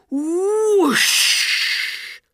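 high, loud rushing whoosh; long hissing sound effect. A narrator's reading. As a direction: burst out a magical whoosh. Magic smoke bursts out.

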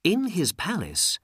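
usual speaking voice

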